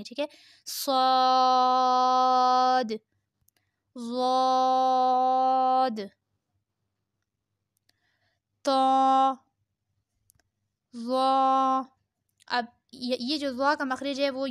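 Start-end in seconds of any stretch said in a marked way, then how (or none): 0.7-11.8 full letter names held on a level tone; drawn-out letters. Shown as a demonstration. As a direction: sustain each rounded vowel evenly. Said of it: "پانچ انگلیوں کے برابر کھینچیں گے".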